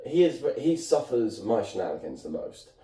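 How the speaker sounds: ordinary delivery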